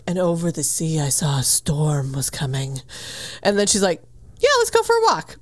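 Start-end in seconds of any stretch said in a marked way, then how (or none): 0.0-2.8 much lower, softer, dramatic hush; low mock-dramatic voice; ominous narrator. 4.4-5.4 much higher, bright perky voice; light and airy, totally oblivious; high mock-sweet agreement; chirpy. Perky character voice.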